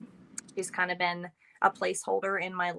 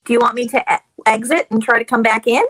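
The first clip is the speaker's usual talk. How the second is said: loud, raised voice over bad line; calling out; 4 semitones higher, 13 dB louder than usual. Real, not acted. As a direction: call it out so they hear.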